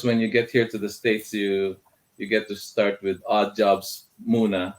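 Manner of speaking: ordinary delivery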